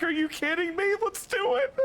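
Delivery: excited squeal. Retold or imitated. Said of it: "And she's like".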